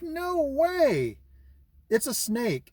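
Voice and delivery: high, loud disbelief at a snake; surprised. Retold or imitated. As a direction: gasp it out like you can't believe your eyes. Spotting a snake in the road.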